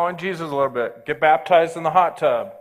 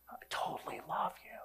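much softer, whispered and tender; God's gentle whisper; 4 semitones higher, 16 dB quieter than usual. Retold or imitated. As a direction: whisper it like God speaking close. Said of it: "I felt God go".

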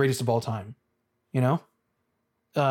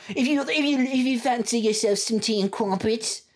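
high-pitched, hammed-up British accent; exaggerated British tea-time accent; mock British accent; 7 semitones higher than usual. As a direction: put on a silly high British voice. Mocks how British the movies are.

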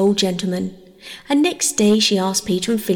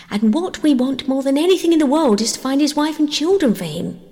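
lightly voiced eager girl, higher pitch; earnest young Bobby; 6 semitones higher than usual. A narrator's reading. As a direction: plead lightly in a child's higher voice.

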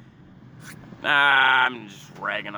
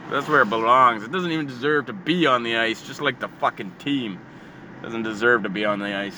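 ordinary delivery

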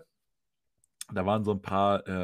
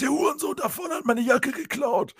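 put-on much higher sing-song drunk yell; mock shrieking accusation; 3 dB louder than usual. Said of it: "er schreit einfach direkt".

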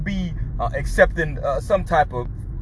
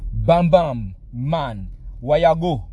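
louder Caribbean call, Bam said twice; Caribbean neighbor voice; 4 dB louder, much slower than usual. Retold or imitated. Neighbor hails him from outside in a light Caribbean accent. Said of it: "he was like".

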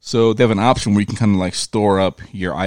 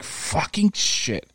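slow, pitched-up exasperated swear; drawn-out curse; 8 semitones higher, 3 dB quieter, much slower than usual. Retold or imitated. After the call drops, a slow exasperated curse. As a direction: drag it out in disgust. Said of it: "I'm like".

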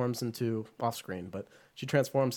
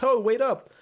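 calling out; 11 semitones higher, 7 dB louder than usual. Retold or imitated. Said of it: "he's like".

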